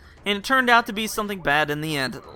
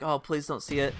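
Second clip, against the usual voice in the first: soft, pleading under the breath; hushed plea; 3 semitones lower, 8 dB quieter than usual. Retold or imitated. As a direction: beg quietly so he won't notice. Silently begging the RA.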